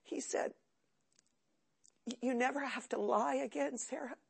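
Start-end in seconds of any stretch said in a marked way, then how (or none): 2.1-4.2 soft forgiving words. Retold or imitated. Stepfather's forgiving words retold.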